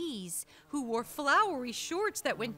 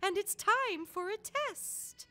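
chirping owl; 8 semitones higher than usual. Acted. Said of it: "chirped the owl".

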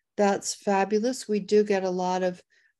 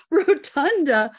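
rotunda called out higher and louder; excited shout; 6 semitones higher, 7 dB louder than usual. Real, not acted. Host shouting a guess during the giveaway, excited. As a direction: punch rotunda out in delight.

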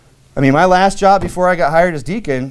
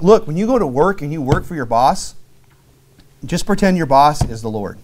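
ordinary delivery

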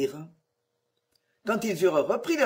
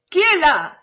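much higher, much louder shout; shouted challenge; 9 semitones higher, 9 dB louder than usual. A narrator's reading. A voice in the old castle shouts at the strangers. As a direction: yell out at intruders. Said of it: "cria une voix".

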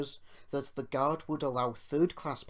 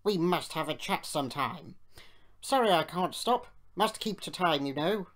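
self-important engines chatting; 6 semitones higher, 6 dB louder than usual. A narrator's reading. Two engines converse.

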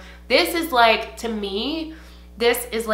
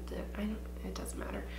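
whispering to herself, dropping the thought; a hushed never-mind under her breath; quick muttered self-correction; whispered aside; 15 dB quieter, faster than usual. Real, not acted.

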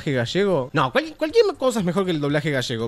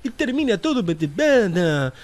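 a hint of a sing-song Italianate Argentine accent; mock tanguero Argentine accent; 3 semitones higher than usual. Mocking how foreigners imitate Argentines, light tanguero Argentine accent. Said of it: "termina siendo así".

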